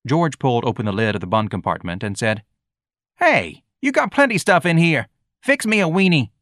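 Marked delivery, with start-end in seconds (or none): 3.2-6.3 put-on high, cheeky boy's voice; pushy street kid. A narrator's reading. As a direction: order it like a brash youngster. Voicing George, pushy.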